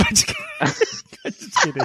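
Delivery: slow laughing tease. Real, not acted. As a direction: giggle out just kidding. Teasing, laughs.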